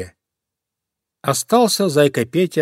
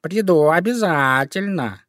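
slow, higher, stretched cat voice; purring cat; 6 semitones higher than usual. Acted. Cat promises fox to come, purring. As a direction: draw the words out like a meow.

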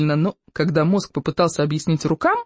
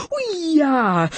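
high, drawn-out yelp; sudden high howl, sliding down; howl of pain; 9 semitones higher than usual. A narrator's reading. Bully gets bitten on the backside, high howl. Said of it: "взвыл Песьедух".

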